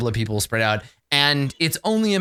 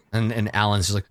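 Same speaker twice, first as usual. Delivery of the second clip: ordinary talk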